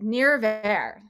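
Nirvar in an English accent, R unrolled. Shown as a demonstration. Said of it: "with an English accent".

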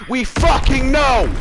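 high, drawn-out angry yell; furious retort to the announcer; exasperated shout. Real, not acted. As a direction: yell it slowly, utterly fed up. Shouting back at game announcer.

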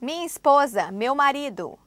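normal talking voice

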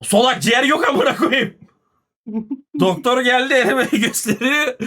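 real laughter spilling over the words; laughing while telling. Real, not acted. Telling the stream about the missing lung, laughing. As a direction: laugh at how absurd it sounds now. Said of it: "Şimdi gülüyoruz tabii de".

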